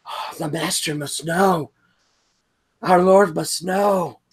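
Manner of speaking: high, urgent spirit voice; alarmed otherworldly servant; high creature voice. Acted.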